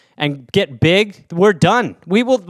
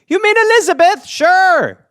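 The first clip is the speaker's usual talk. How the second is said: high put-on voice, puzzled; silly aunt voice; 11 semitones higher, 3 dB louder than usual. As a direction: play the dim aunt. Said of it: "aunt answers in silly voice".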